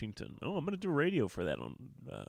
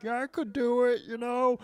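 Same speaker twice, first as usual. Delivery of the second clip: high, louder imitation of a drunk heckler; 12 semitones higher, 5 dB louder than usual. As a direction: pipe up as a mock drunk audience guy. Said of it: "super drunk, buff dude in the front row".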